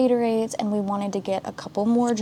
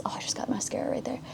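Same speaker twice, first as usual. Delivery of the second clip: whisper; 3 semitones higher, 9 dB quieter, faster than usual. Real, not acted. Whispering aside while doing makeup.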